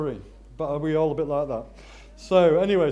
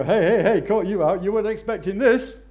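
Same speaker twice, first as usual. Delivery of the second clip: higher, louder, fast teasing call; spring the surprise like a playful friend; Jesus gleefully catching Mary out; 6 semitones higher, 10 dB louder, faster than usual. Retold or imitated.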